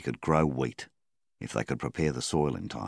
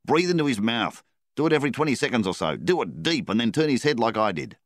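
high, loud, fast commands; urgent instructions; 7 semitones higher, 5 dB louder, faster than usual. A narrator's reading. Donnie directing Flynn's rescue breaths, loud fast high instructions. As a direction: rattle off orders.

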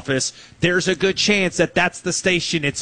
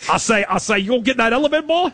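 Foghorn Leghorn; 7 semitones higher than usual. Retold or imitated. [name] heard as a cartoon rooster, blustering impression. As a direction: put on a cartoon Southern rooster. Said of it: "Foghorn Leghorn".

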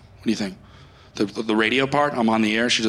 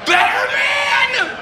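drawn-out DJ title call; 14 semitones higher, much slower than usual. Acted. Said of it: "called Better Man".

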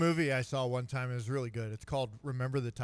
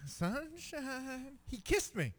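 quoting a favorite movie line to friends, softly, in a higher voice; high, soft movie-character voice; 9 semitones higher, 5 dB quieter, much slower than usual. Retold or imitated. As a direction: lift the pitch and hush it, quoting the film. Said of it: "movie quote".

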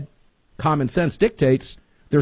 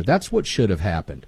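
ordinary talk, nothing marked

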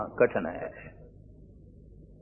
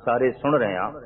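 ordinary talk

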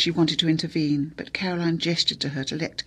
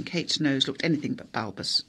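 ordinary delivery, nothing marked

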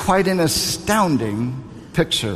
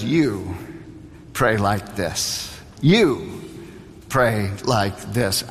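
You ... pray like this, slow and low with a pause after You; slow emphatic instruction; 5 semitones lower, slower than usual. Acted. Preacher voicing Jesus teaching the disciples to pray, slow and emphatic. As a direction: lean on You, pause, then pray like this slowly. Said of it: "But then Jesus says".

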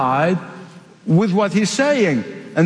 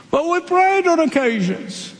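higher, pleading protest; a higher, whiny plea; pleading foolish ones; 8 semitones higher than usual. Acted. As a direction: beg to be let in.

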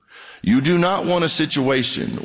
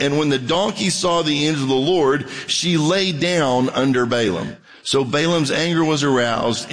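raised, measured Bible reading; scripture reading; 5 semitones higher than usual. Acted. Preacher reading scripture to the congregation, raised and measured. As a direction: read the passage to the church aloud.

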